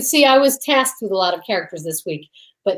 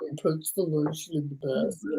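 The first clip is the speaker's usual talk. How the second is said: low, soft menacing murmur; low whispered menace; creepy killer voice; 7 semitones lower, 11 dB quieter than usual. Retold or imitated. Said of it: "such a creepy voice".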